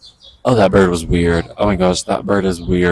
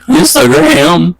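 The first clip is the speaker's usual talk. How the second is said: high, slow, cracking on Instagram; the voice breaks up high on Instagram; 6 dB louder, slower than usual. Real, not acted. Reading out to OnlyFans followers, voice cracks high. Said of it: "Ugh, that voice crack".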